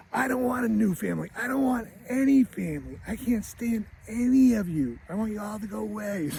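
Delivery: high-pitched mock tantrum of a little boy; angry kid. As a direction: wail it like a furious child, without real volume. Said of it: "he gets angry again".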